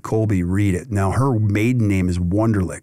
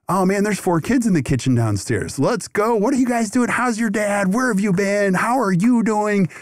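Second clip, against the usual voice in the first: excited greeting; 10 semitones higher than usual. Retold or imitated. Greeting kids in his kitchen.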